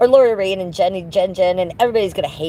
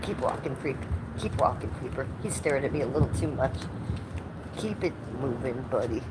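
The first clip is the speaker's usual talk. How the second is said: much softer, low muttering at a creep; muttered under breath; 4 semitones lower, 12 dB quieter than usual. Real, not acted. Streamer warning off a staring stranger, soft low mutter. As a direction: mutter it so he can't hear.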